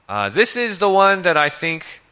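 ordinary delivery

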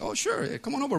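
friendly pastor. Retold or imitated. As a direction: voice the pastor up high and gentle. Pastor's friendly reply voiced.

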